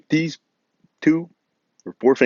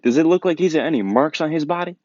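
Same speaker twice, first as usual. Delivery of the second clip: high-pitched, incredulous self-defense; indignant protest; 8 semitones higher than usual. Retold or imitated. Accused kid to office staff, indignant protest. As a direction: retell an indignant plea, pitched well up.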